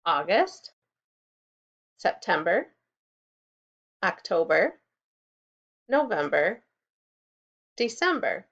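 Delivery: each month spaced and rising; slow listing. Shown as a demonstration. Teacher lists the months slowly for kids to repeat. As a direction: model the months one by one. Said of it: "Can you try saying those months?".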